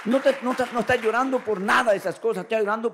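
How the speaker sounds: loud, rising preacher's cry; loud impassioned sermon; fervent preaching. Real, not acted. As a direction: preach it out with full force. Preacher building to full force.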